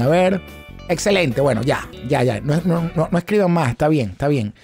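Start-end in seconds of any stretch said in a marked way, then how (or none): none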